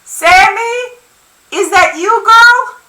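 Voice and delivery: high, loud grandmother calling from indoors; ringing call. A narrator's reading. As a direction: call out to someone at the door. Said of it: "Amurabia's ringing voice from indoors".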